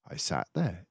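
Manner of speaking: ordinary delivery, nothing marked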